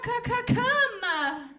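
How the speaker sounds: high, loud squawked stutter climbing like a bird; squawking bird caw. Acted. Imagined lover carried away by bird sounds, squawking. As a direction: caw the words out at climax.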